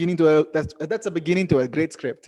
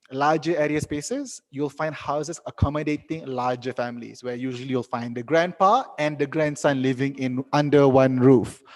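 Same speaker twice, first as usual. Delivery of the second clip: ordinary talk, nothing marked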